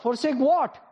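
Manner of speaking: normal talking voice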